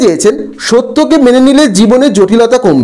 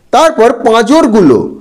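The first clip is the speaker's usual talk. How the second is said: ordinary talk, nothing marked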